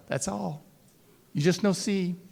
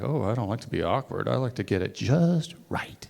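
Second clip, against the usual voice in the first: mock perfectionist; 5 semitones lower, 3 dB quieter than usual. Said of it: "They're like".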